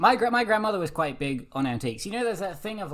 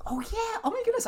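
high, sudden burst of recognition; surprised. Real, not acted. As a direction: squeal of realizing something. Sudden recognition of Tesla trucks.

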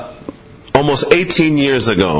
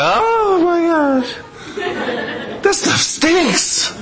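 high, laughing disgust; disgusted reaction; 12 semitones higher, much slower than usual. Retold or imitated. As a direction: recoil from the smell, laughing. Reaction to stinky leftovers.

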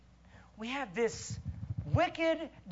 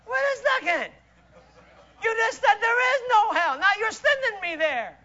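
higher, incredulous comeback; mock bafflement; 9 semitones higher, 8 dB louder than usual. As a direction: play up the baffled preacher. Preacher's mock-baffled reply.

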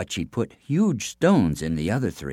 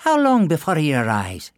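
higher, louder wife's voice; Una asking; 8 semitones higher, 6 dB louder than usual. A narrator's reading. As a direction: ask briskly as the clever wife. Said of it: "she asked".

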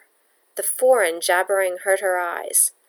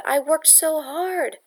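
dismayed plea; 8 semitones higher, slower than usual. Acted. Bella protests, dismayed.

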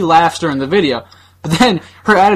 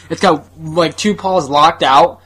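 ordinary talk

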